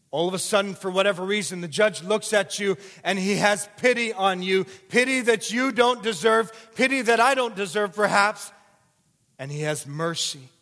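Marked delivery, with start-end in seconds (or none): 0.1-8.5 louder and higher preaching as the scene mounts; building preaching. Acted. Preacher painting the sentencing, building to full force. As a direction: swell into it, pushing the drama harder line by line.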